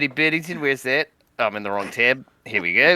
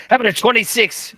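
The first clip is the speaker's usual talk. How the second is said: high, quick showy reveal; more dramatic; 7 semitones higher, faster than usual. Pretend. Player revealing a big roll to the table, dramatic. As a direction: announce the roll with playful drama. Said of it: "could have been more dramatic".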